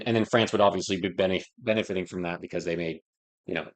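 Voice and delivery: ordinary delivery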